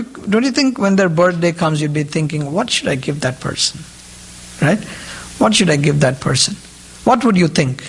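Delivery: normal talking voice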